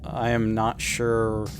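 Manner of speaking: ordinary talk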